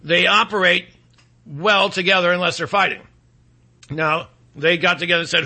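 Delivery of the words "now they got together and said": normal talking voice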